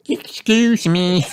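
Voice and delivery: put-on high, slow little-girl voice; Peppa. A narrator's reading. Child excusing her burp, high slow voice.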